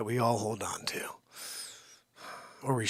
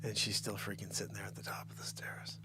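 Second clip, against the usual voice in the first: whisper; 4 semitones lower, 11 dB quieter than usual. Real, not acted. Whispered aside.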